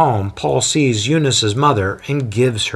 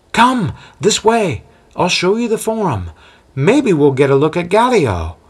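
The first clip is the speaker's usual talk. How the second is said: lightly voiced eager local, higher; eager guide; 8 semitones higher, 3 dB louder than usual. Guide leading his guest to the forum, lightly eager. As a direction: lead the visitor on with enthusiasm.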